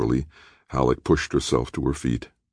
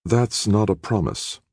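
slow, higher, cool rebuke; icy; 7 semitones higher than usual. A narrator's reading. Halleck coldly pressing Jordan for a real promise, icy and slow. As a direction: let the words land cold and deliberate. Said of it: "Halleck said icily".

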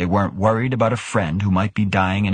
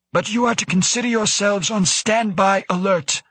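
high-pitched, stern director character voice; director's orders; 12 semitones higher than usual. A narrator's reading. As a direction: issue a standing order in a much higher voice. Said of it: "the director added".

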